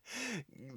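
helpless, high laugh; soft real laughter at your own slip; trailing fit of giggles. Real, not acted. Laughing at own word mix-up.

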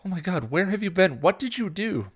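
high, loud, alarmed questions; frantic questioning. A narrator's reading. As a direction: demand answers in a panic. Frantic sister questions the vampire.

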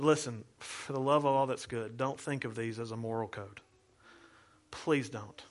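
a soft, heartfelt please-don't; earnest plea. Real, not acted. Pastor pleading with congregation.

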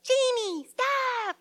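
put-on very high little-kid shout; toddler yelling. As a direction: squeak it like a three-year-old copying mom. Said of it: "they're yelling".

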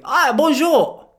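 high, stretched-out French greeting; exaggerated French accent, slow and high; mimicked French accent. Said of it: "going up to a little French kid".

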